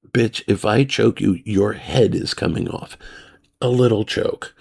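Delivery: ordinary delivery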